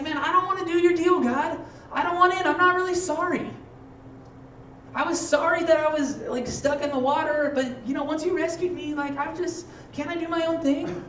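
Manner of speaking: high-pitched, petulant protest; defiant Jonah. Acted. Preacher as Jonah refusing God's deal, petulant high-pitched protest. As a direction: push back in a high, hurried complaining voice.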